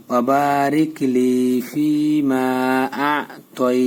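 slow ওয়াবারিক লি ফি মা, with long vowels; joined recitation. Shown as a demonstration. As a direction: draw out the last তৈ and stop. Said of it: "এখন মিলাই". (মিলিয়ে, তৈ-তে টান দিয়ে তিলাওয়াত)

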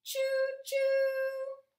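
high, loud choo choo like a steam whistle; train whistle call. A narrator's reading. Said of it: "Papa Pufferbelly cheered".

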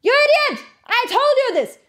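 high-pitched yell, as if losing your temper; uncontrolled angry shout. Shown as a demonstration. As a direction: shout it out with no control. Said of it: "not very controlled and shouting out like".

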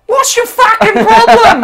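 loud, high mock-furious yell with a laugh; laughing angry shout. Real, not acted. Player whose Australia is attacked, loud laughing angry yell. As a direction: yell at your friend half laughing.

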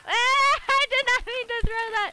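high loud laughing panic; shrieking laugh in panic; panicked laughing. Real, not acted.

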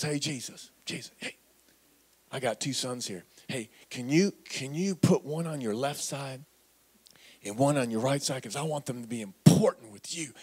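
low, soft, half-whispered request; pleading mama whisper. Retold or imitated. Mother quietly pleads with Jesus for her two sons. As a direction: beg a favour under your breath. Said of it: "So mama says".

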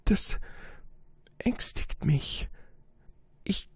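high, hushed, slow halting words; a shy boy cowed by the king; stammering, intimidated. Acted. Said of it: "stammelte der kleine Prinz".

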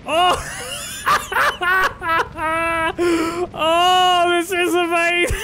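high-pitched, through real laughter; helpless, squealing laughter in the words; laughing hard. Real, not acted. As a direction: crack up with delight. Helpless laughing fit mid-game.

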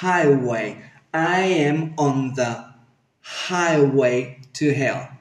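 slow "highway" with a gentle, breathed H; soft H and W. Shown as a demonstration. Teacher models it for learners, soft "highway". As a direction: glide softly through "highway", no hard H. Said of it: "Suavecito esa H".

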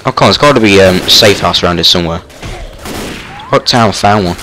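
frantic shouting. Real, not acted. Desperate run to the safe house.

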